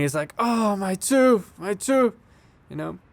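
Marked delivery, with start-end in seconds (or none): 0.4-2.1 high, drawn-out wail at normal volume; mock scream. Bully wailing over his lost tooth, mock scream. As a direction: imitate a panicked yell, held back in volume. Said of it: "Joe Santini's screaming".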